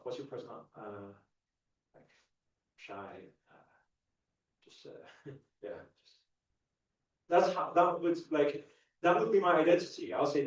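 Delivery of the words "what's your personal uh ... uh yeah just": soft, fading, trailing off into a whisper; shy mumble. Retold or imitated. Shy boy asked to introduce himself, soft trailing mumble. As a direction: shrink away mid-answer like a shy teen. Acting his shy younger self.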